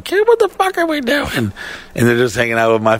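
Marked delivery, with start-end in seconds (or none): 0.0-1.5 very high mock female voice; high-pitched girlfriend imitation; girlfriend's voice. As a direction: squeak it out as the complaining girlfriend. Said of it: "and she was like".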